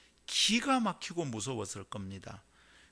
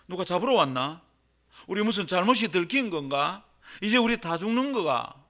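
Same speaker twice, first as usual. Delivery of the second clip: louder, raised Gyeongsang-style worried questions; mock alarm; 6 semitones higher, 11 dB louder than usual. Preacher voicing shepherds fearing they are caught, mock alarm. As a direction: lightly act out panicked shepherds.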